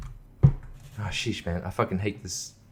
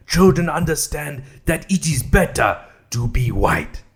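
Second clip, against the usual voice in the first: slow, mock-solemn declamation; put-on reading voice. Vlogger mocking the research claim, in a put-on voice. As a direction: read it out in a stilted, emphatic character voice.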